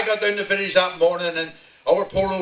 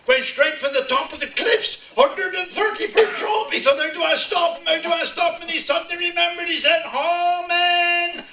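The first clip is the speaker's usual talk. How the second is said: higher and higher toward the punchline; building tension; 12 semitones higher than usual. A narrator's reading. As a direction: race up to the cliff edge. Climax of the horse joke.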